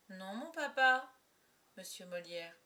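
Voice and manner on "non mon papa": put-on higher, louder, slow little-girl voice; projected, drawn-out stage reply; child's stage denial. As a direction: deny it innocently to papa. Child answers in the play.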